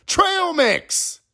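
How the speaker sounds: "Trail Mix" squeaked very high. Pretend. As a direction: top the jingle with "Trail Mix" at peak pitch.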